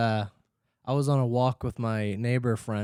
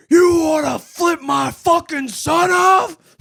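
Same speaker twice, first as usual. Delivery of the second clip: imitated enraged scream; 14 semitones higher, 9 dB louder, slower than usual. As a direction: scream it like a furious dad across the yard.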